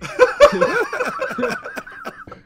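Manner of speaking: high, loud burst of laughter. Real, not acted. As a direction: break up laughing. Laughing at own pun.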